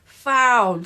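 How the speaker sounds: high, loud long call; screamed appeal. A narrator's reading. Team appealing for a foul, loud scream. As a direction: scream it at the referee. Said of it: "screamed Margaret's team".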